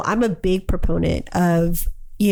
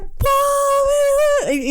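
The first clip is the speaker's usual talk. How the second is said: crying daughter. Retold or imitated. Mother mimicking her sobbing daughter, high wail. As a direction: whimper it like a heartbroken kid. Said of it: "and she's like".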